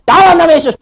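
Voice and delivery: loud, high mock rebuke; retold commanding rebuke. Preacher retells facing armed robbers, commanding rebuke.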